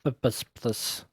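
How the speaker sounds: drawn-out Russian guard voice; Russian accent. Pretend. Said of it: "not doing Russian accent any favors".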